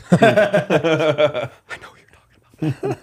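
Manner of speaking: slow whispered aside through a laugh. Real, not acted. Telling podcast hosts, a slow laughing whispered aside. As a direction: let the secret slip in a whisper. Aside about a famous drummer.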